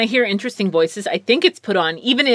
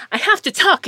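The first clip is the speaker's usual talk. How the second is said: high projected stage voice; overly formal actor; theater voice; 10 semitones higher than usual. Retold or imitated. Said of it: "that theater thing".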